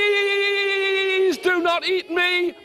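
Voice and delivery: high, slow plea from a scared dragon; pleading dragon. Acted. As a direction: beg like a frightened beast. Dragon begging Stan.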